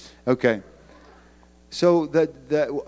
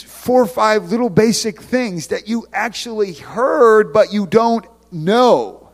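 emphatic preaching; 6 semitones higher, 7 dB louder than usual. Acted.

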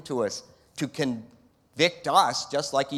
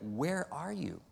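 quiet asking; 10 dB quieter, slower than usual. Shown as a demonstration. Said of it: "so he's not yelling like I am".

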